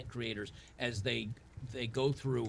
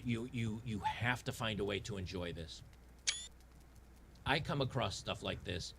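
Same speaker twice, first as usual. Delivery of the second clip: usual speaking voice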